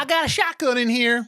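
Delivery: high, hammed-up Southern belle accent, pitched well up; Southern accent done as a big, high parody; mock Southern drawl. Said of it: "Gone with the Wind kinda accent".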